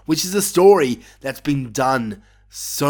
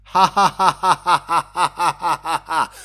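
reading out mocking laugh; 3 semitones higher than usual. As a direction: cackle out the written ha ha. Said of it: "in big capitals".

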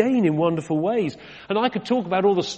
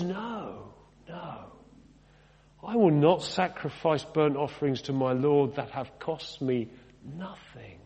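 hushed, lower, slowing to the end; solemn refusal; 4 semitones lower, 7 dB quieter, slower than usual. Acted. King David turning down a free field, hushed and solemn. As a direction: refuse quietly and gravely.

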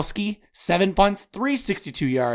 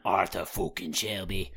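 mock British gangster; 6 semitones lower, 6 dB quieter than usual. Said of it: "with a British accent".

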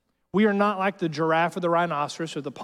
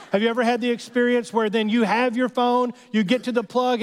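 higher and louder, pressing the question; excited repeat; 7 semitones higher, 5 dB louder than usual. Real, not acted. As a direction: lean in harder the second time. Repeat, pushed harder.

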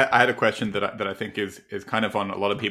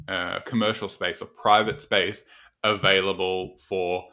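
ordinary talk

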